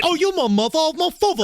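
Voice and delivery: high, hammed-up British accent; fallen-off UK English accent. Shown as a demonstration. Comedian repeating his bit after praise, exaggerated UK accent. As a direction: put on a bad English accent, pitched well up. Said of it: "That's pretty good".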